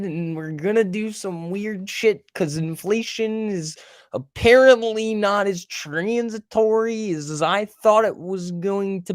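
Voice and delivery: high, slow, put-on voice of an old central banker; Janet Yellen impression. Streamer mocking the Fed chair, high slow parody voice. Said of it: "my Janet Yellen impression is spot on".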